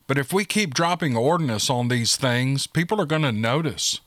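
plain reading voice

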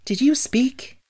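high-pitched, astonished little question; wondering. A narrator's reading. Girl hears a scarecrow talk, wondering. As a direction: ask it in wide-eyed wonder. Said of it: "asked the girl in wonder".

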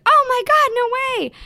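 high, loud disbelieving gasp of a quote; mock shock. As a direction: imitate a roomful of stunned people. Quoting the stunned room.

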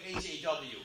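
usual speaking voice

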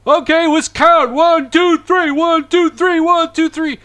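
very high, loud coach count-off; loud workout count. Retold or imitated. Retelling the workout leader counting reps, loud. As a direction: bark out the reps like a hyped trainer. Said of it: "he was extremely loud".

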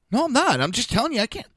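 high, loud retold objection; aggravated protest. Man retelling his argument with the shelter lady, aggravated and high-pitched. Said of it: "getting all aggravated and emotional".

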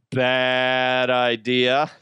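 "bad" drawn out like a bleat; long, punning baa on "bad"; sheep-bleat pun. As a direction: stretch it slowly into a sheep's baa. Sheep pun as dad joke.

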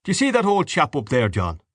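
a storyteller's light voice for an Irish father; lightly voiced Mister Dedalus. A narrator's reading.

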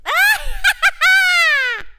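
high shrieking monkey laughter; loud high-pitched monkey cackle. Acted. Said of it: "laughed the monkey".

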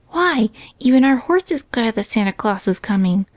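ordinary delivery

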